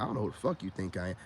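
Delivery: low, quick, hushed telling-off; calm but firm. Retold or imitated. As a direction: cut her down quietly and fast. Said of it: "which in a calm way".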